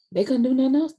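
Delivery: loud, high-pitched incredulous complaint; exasperated. Retold or imitated. Quoting sister's exasperated reaction.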